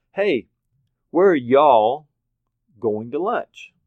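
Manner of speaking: put-on loud East Coast kid calling out; East Coast accent. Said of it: "with an East Coast accent".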